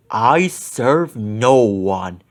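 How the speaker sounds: low, very slow ghostly witch voice. A narrator's reading. The witch's ghost rejects Ben, slow low ghostly voice. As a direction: defy him with eerie slowness.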